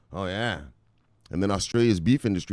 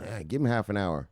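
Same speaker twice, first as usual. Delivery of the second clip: ordinary talk, nothing marked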